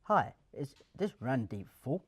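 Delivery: slow, lightly robotic cold-call voice; a hint of a machine reading a script; imitating an AI caller. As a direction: mimic a scripted automated caller, measured and stiff. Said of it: "you could just tell it was an A I call".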